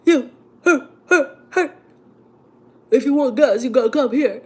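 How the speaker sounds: imitating the pushy gas station guy; gruff attendant. Mock pushy gas attendant yelling at driver.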